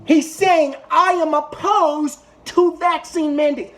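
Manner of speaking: high, emphatic angry rant; real exasperated anger. Real, not acted. Berating hosts over the vaccine skepticism claim, exasperated anger.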